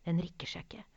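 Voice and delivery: frightened hushed whisper. Acted. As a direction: breathe it, barely a whisper. Mother scared at troll's table. (redd hvisking)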